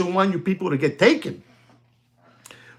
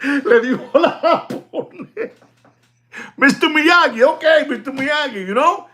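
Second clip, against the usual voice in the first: laughing; 12 semitones higher, 3 dB louder than usual. Real, not acted. Laughing at the nickname.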